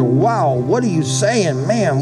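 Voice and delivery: usual speaking voice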